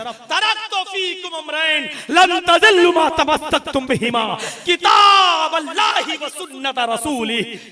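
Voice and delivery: Arabic hadith recitation. Acted. Preacher reciting the hadith to the crowd, loud and measured. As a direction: intone the Prophet's words with weight.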